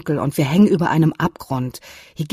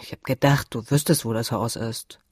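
grumbled; 4 semitones lower than usual. A narrator's reading. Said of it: "brummte Alex".

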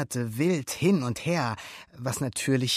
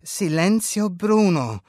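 loud, slow, higher and confident; more confident; 6 semitones higher, 7 dB louder than usual. A narrator's reading. Luca repeats it louder for Alberto, more confident. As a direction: declare it with growing confidence. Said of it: "jetzt schon selbstbewusster".

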